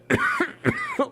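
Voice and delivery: high, forced fake cough. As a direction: cough on purpose to hide choking. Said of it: "I'm pretending I'm coughing".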